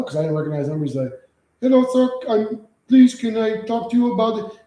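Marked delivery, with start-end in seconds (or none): 1.6-4.6 high, pleading put-on caller voice; scam caller voice. Streamer mimics scam caller pleading on phone, high put-on voice. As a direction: mimic the scammer begging you to listen.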